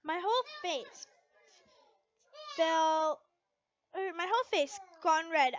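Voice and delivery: ordinary delivery, nothing marked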